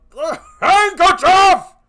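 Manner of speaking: high, loud, raging cry; furious shout. Acted. Jealous husband screams at his wife for the handkerchief. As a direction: roar the word at her. Othello explodes at Desdemona.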